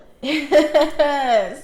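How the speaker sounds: loud burst of laughter; real loud laugh. Real, not acted. Laughs after story.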